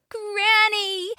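put-on high girl voice calling out; relieved shout. Little Red greeting Granny, high relieved call. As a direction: cry out with relief. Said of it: "Little Red shouted with relief".

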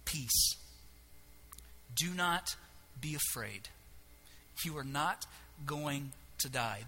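low, soft, spaced-out comfort; calm a frightened listener, pausing between phrases; hushed, slow divine reassurance; gentle reassurance. Acted. God reassures a frightened Gideon.

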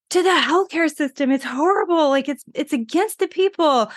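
high-pitched, worked-up protest at ordinary volume; retold upset rant. Upset mother answers her roommate about healthcare, mock-distraught. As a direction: imitate yourself wailing a complaint, no real tears. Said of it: "I'm crying, and I'm angry".

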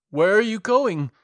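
loud, high alarmed cry; wild unhinged ranting. A narrator's reading. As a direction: cry out in sudden panic. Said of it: "cried the madman".

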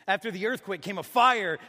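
sermon peak on the fire; loud proclamation. A narrator's reading. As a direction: call it out like a blaze. Narrating the fire.

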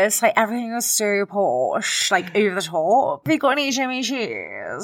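a hint of a slow posh princess; lightly voiced posh royal, over the top. Playing the princess in The Windsors, lightly posh and slow. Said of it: "she was Princess Eugenie".

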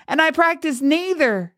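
put-on high, protesting girl's voice; crying out. A narrator's reading. Tearful girl denying witchcraft, high crying protest. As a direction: cry it out high in self-defence. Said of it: "she cried".

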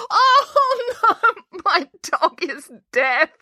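very high, slow sobbing wail; cling to the dog and wail. Acted. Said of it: "April didn't stop wailing".

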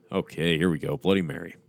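ordinary delivery, nothing marked

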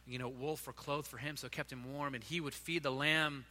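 gentle story narration. Acted. Tender bedtime-story narration of shepherd.

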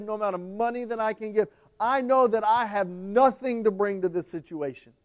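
loud, high, pleading cry to God; desperate plea. Acted. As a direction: cry out as if all hope is gone. Hannah crying out to God.

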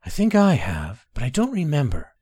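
ordinary delivery, nothing marked

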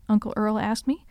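usual speaking voice

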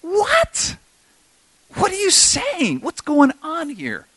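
very high, incredulous squeal; hammed-up bewildered cry; perplexed Peter. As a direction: stretch it out in high-pitched bafflement. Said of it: "while Peter was inwardly perplexed".

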